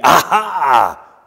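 loud drawn-out "aha"; cry of wonder and fear. Acted. Preacher trying readings of the demon's cry, astonished "aha". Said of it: "a note of wonder and fear".

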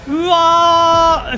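long high cry of joy; excited yell. Real, not acted. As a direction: whoop it out in disbelief. Surprised win, excited cry.

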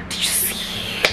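whispered, no voice; awed whisper. Pretend. Onlookers as the man walks in, awed whisper. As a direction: hush it like a stunned onlooker.

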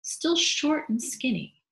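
usual speaking voice

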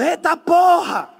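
high, drawn-out exclamation; mock startled yell. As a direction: come out hollering, slightly hammed-up. Sai gritando do escorregador. (grito encenado, agudo e arrastado)

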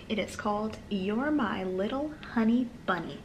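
usual speaking voice

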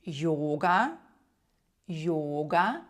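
йога starting with a y-glide and long o; йога drawn out, rising, y onset; initial Y sound. Shown as a demonstration. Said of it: "На початку слова як звук Й".

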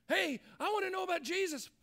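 high, eager mock call. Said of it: "is calling out".

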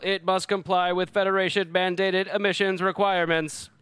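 loud, high announcing robot; robotic voice. Acted. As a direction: bark it out like a ship's computer. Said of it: "a robotic voice shouts".

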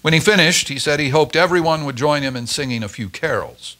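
ordinary delivery, nothing marked

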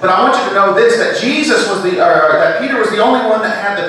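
high, loud pulpit delivery; full-force Sunday sermon; fervent preaching. Acted. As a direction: drive it home to the congregation.

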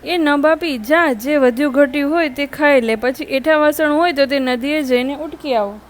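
usual speaking voice

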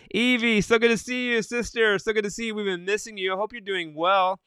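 high, bright-eyed welcome with a laugh; excited greeting. Real, not acted. Greeting returning viewer Evie happily.

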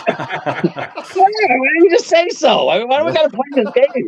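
very high-pitched, laughing protest; squealed comic complaint over laughter; mock exasperation. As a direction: act out relieved, exasperated understanding.

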